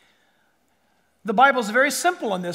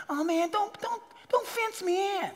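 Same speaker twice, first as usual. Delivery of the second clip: high, whining mock child voice, softer; hammed-up whiny protest; whiny kid; 10 semitones higher, 4 dB quieter than usual. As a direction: whine like a kid being told no. Mocking a whiny rebellious child.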